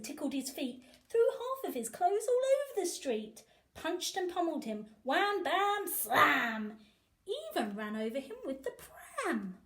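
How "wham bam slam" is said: ordinary delivery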